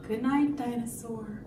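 soft sleepy-time voice, ending in shh; hushed goodnight. Acted. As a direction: settle the dinosaur down gently. Puts the story's dinosaur to sleep.

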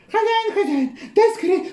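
high frantic hen voice, laughing; hen calling master. Acted. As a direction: cry out like a flustered hen.

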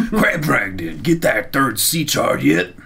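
slow, high gravelly wrestler voice; Macho Man. Retold or imitated. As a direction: growl it like a wrestling promo. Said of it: "Macho Man".

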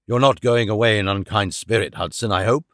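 put-on a touch of a cowed old man; tame, meek. A narrator's reading. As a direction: meekly plead with the servant not to leave. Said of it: "with a tameness which made my blood boil".

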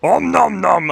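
slow, high munching monster voice; hammed-up hungry creature. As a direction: gobble like a greedy cartoon beast. Spider hungry for peasants.

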